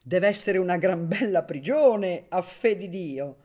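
loud, high, exuberant banter; jovial teasing. A narrator's reading. Magistrate joking with the baron after a laugh, jovial. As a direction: boom it out with relish.

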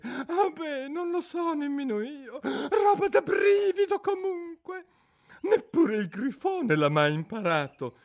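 high, mournful creature voice; a sorrowful old turtle reminiscing; mock turtle voice. Mock turtle telling Alice about school under the sea, mournfully.

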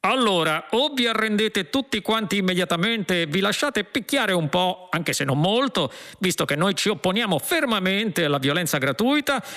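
high-pitched, self-important policeman; shouted ultimatum. A narrator's reading. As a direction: deliver the threat in a climbing cop's cry. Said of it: "gridò il poliziotto".

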